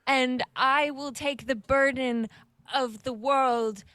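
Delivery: slow, deliberate heroic declaration; stately character voice, drawn out word by word; Cather voice. Acted. Player pledges to take the world's burden, slow Cather voice. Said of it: "the voice of Cather".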